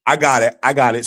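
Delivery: normal talking voice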